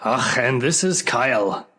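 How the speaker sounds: put-on Scottish accent for the podcast intro; hammed-up Scottish host welcoming listeners; a light Scottish accent that doesn't quite hold. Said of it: "I think my accent slipped a bit there".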